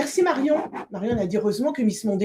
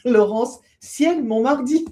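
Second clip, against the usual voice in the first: slow, louder ciel, mon mardi through laughter; laughing; 3 semitones higher, 6 dB louder, slower than usual. Real, not acted. Cohost reads a viewer's title, laughing.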